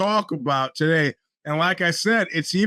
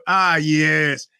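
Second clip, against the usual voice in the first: ordinary talk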